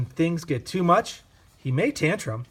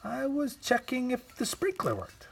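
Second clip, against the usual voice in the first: put-on much higher and softer, a child's innocent excuse; sheepish excuse; 11 semitones higher, 4 dB quieter than usual. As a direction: play innocent, caught out but pleading. The child character excuses himself.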